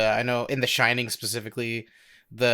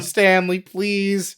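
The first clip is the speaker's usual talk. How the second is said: higher, louder mock plea; pleading; 7 semitones higher, 4 dB louder, much slower than usual. Worn-out actor begging the director to stop, mock pleading. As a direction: beg like a worn-out actor, hammed up. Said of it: "they were just brought to tears".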